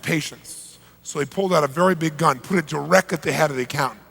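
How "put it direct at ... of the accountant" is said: normal talking voice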